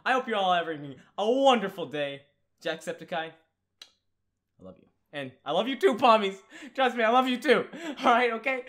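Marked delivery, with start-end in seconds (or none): 3.3-4.9 slow breathy whisper, half joking; mock tender whisper. Thanking Jacksepticeye for his comment, a slow mock-tender whisper. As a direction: murmur it close to the mic like a secret.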